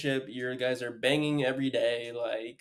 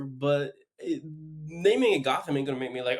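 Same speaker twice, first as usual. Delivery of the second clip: ordinary talk, nothing marked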